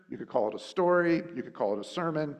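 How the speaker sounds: ordinary talk, nothing marked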